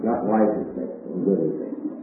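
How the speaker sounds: soft, stressed correction on good; quiet insistence on good; contrast good with wise. Shown as a demonstration. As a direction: lean on GOOD against wise. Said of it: "is called good and faithful".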